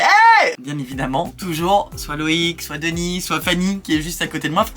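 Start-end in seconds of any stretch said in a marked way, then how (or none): 0.0-0.5 sudden loud high "hey"; loud shout. Retold or imitated. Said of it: "je commence à parler hyper fort".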